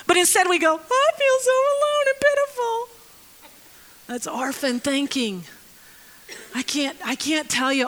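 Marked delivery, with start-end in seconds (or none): 0.7-2.9 high, whimpering mock self-pity; whiny self-pity. Preacher mocking the lonely orphan's self-pitying whine. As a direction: wail it like a pitiful lonely orphan.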